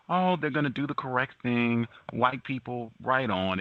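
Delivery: mocking excited supporters. Host mocking supporters who cheer the delay, high mock cheer. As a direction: sarcastically mimic naive celebrating fans. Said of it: "they use words, get people excited".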